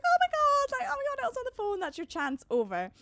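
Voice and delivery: high, loud excited yelling; family shouting. Retold or imitated. Family at the airport cries out, high and loud. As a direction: cry out that the chance is gone.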